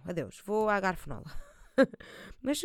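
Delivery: normal talking voice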